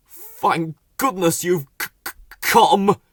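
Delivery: stuttering with cold; teeth-chattering stammer; shivering voice, blue with the cold. Acted. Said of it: "shivering and moaning".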